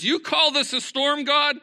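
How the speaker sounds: high, loud defiant yell; Lieutenant Dan yelling. Acted. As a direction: yell it up at the storm, challenging God. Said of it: "he's yelling at the storm".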